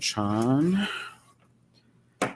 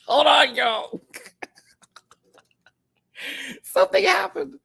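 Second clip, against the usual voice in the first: high, loud laughing words; laughing panic; 6 dB louder than usual. Real, not acted. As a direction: laugh through the chaos.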